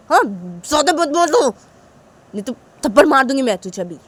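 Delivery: loud, stern warning; stern mother. Acted. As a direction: lay down the law. Mother warns the children. (ज़ोरदार, सख़्त चेतावनी)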